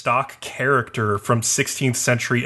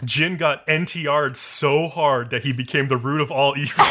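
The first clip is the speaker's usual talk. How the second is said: ordinary talk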